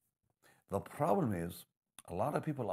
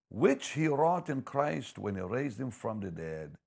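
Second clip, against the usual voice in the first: louder and higher, building preacher fervour; fervent scripture reading; 5 semitones higher, 7 dB louder, slower than usual. Acted. Preacher reading Ephesians to the congregation, proclaiming loudly. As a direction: proclaim the verse with mounting force.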